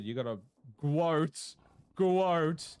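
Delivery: second "quote" loud and high; slow, then punch the last "quote"; drawn-out build to a loud, high "quote"; emphatic quote. Real, not acted. Emphatic command.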